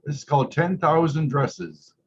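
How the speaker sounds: gentle storybook reading; lightly voiced read-aloud; reading to children. A narrator's reading. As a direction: read it like story time for kids. Reading picture book aloud.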